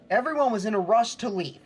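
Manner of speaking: normal talking voice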